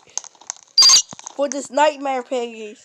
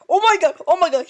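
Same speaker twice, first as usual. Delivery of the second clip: shocked; 4 semitones higher, 6 dB louder, much faster than usual. Real, not acted. Surprised in game.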